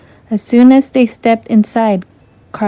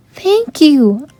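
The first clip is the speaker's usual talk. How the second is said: cried out; 6 semitones higher than usual. A narrator's reading. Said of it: "Ariel cried".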